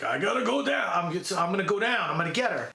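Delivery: lightly imitated action hero, determined; a hint of movie tough guy resolve; Rambo. Hero vows to rescue her, lightly imitated resolve. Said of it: "and he's like".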